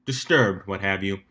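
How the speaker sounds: usual speaking voice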